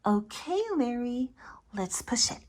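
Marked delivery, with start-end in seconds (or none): none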